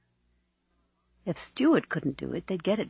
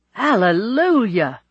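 long, loud, raised hallelujah; jubilant shout; 5 semitones higher, 10 dB louder, slower than usual. A narrator's reading. Bishop rejoicing at his friend's engagement, shouting. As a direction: shout it out in drawn-out joy. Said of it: "shouted his bishop".

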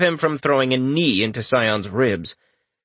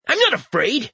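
irritated; 13 semitones higher than usual. Acted. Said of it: "the sprite said with irritation".

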